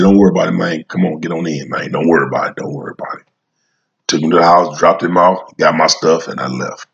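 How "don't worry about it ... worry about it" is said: soft and low, don't worry about it; calm, soft reassurance. Retold or imitated. To the young man who broke the door, quiet calming. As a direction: hush it down, keep him calm. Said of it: "I said".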